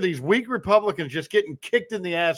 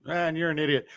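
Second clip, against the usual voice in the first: ordinary talk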